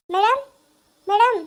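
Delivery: loud call across the room; calling for the madam. Acted.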